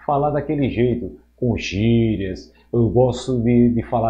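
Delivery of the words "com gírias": ordinary delivery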